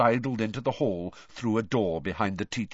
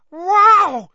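very high, loud wow; amazed; 14 dB louder than usual. A narrator's reading. Little boy thrilled by the squid, high amazed cry. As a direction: exclaim in boyish wonder.

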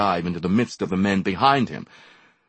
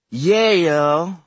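sulky; 11 semitones higher, 8 dB louder than usual. A narrator's reading. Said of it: "answered sulkily".

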